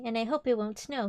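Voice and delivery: usual speaking voice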